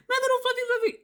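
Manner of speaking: high cooing baby talk, imitating him; sweet baby talk to the dog. Boyfriend turning from her call to coo at his dog. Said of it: "He always talked sweet to Fluffy".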